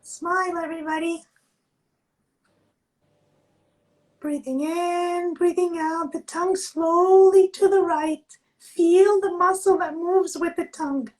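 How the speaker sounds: slow, calm instructor voice; guided exercise. Acted. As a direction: guide the class gently, drawing words out. Leads a face yoga exercise.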